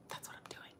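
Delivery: unvoiced conspiratorial whisper; whispered confession about the bags. Real, not acted. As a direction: hush it like a secret. Whispered aside.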